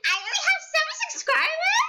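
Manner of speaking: high, loud, fast laughing disbelief; shocked squeal. Real, not acted. Seeing her subscriber count, high laughing shock.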